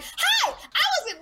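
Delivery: very high, gushing fan voice; eager high hi. Retold or imitated. Imitating Caroline greeting Frankie in a very high chirp. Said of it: "Caroline was like".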